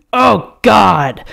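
moan. A narrator's reading. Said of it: "I moan".

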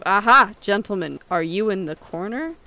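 loud, pouncing "aha", then easing; robbers' cry. A narrator's reading. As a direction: cry out on discovering intruders. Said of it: "cried they".

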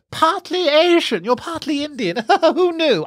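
high, slow put-on lady voice, chuckling at the end; posh woman. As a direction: play the snooty stranger delivering a verdict. Woman after the gig, quoted.

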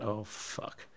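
a very soft, sinking oh fuck; dismayed curse under breath. Retold or imitated. Caught by the gaffer, he curses very softly. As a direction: mutter it to yourself, caught out. Seeing the gaffer walk past.

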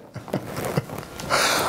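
a quiet unhinged breathy laugh; soft breathy chuckle. Acted. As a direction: chuckle to yourself, barely voiced. Narrator's mad chuckle.

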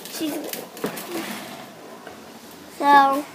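ordinary delivery

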